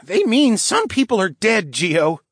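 put-on high, loud, irritated boy; sharp, impatient scolding; hissed rebuke. A narrator's reading. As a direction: snap at a friend in a high kid's voice. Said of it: "Pratt hissed".

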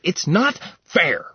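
high, loud tantrum whine; petulant prince. Acted. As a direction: stamp your foot like a spoiled child. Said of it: "stomped his foot like a petulant child".

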